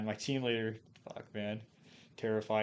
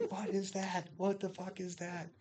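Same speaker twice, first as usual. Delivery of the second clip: high-pitched, held-back imitation of screaming; mock scream; 8 semitones higher, 5 dB quieter than usual. Drill instructor story retold to a friend, high mock scream. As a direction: imitate a scream high up without full volume. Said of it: "he's, like, screaming".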